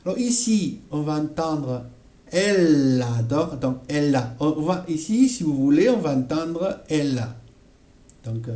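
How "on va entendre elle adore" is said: normal talking voice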